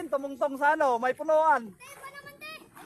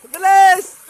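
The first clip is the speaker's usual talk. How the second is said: loud, higher call to hurry; shouting; 5 semitones higher, 16 dB louder than usual. Real, not acted. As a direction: yell at them to hurry. Urging kids to hurry.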